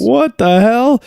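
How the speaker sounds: hammed-up baffled protest, slow and high; mock outrage. Teen baffled by changed pants, mock outrage. Retold waking in new clothes.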